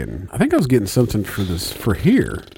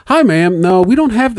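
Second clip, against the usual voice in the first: bright, much higher, sugary phone greeting; sweet customer-service voice; 10 semitones higher, 4 dB louder than usual. Retold or imitated. Clerk to caller, suddenly high and sweet. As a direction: switch instantly to polite retail cheer. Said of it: "you switched that all real quick".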